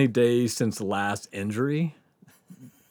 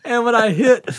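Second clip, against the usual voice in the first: laughing; 9 semitones higher than usual. Real, not acted. Laughing describing the dive.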